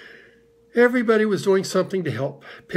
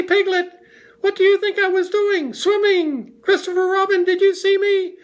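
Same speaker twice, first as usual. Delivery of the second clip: very high, loud little squeal; excited squeak; 6 dB louder than usual. A narrator's reading. Roo wants Kanga to admire the swim, squeaking excitedly. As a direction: squeak it out, bursting to be noticed. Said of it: "squeaked Roo excitedly".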